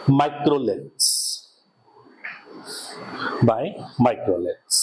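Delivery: very slow, each word spaced for writing; slow dictation. Acted. Teacher dictating notes to students, very slowly. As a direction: dictate word by word so they can keep up.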